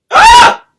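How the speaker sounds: very high loud shriek; sudden scream. Real, not acted. Sudden scream at shaky hand.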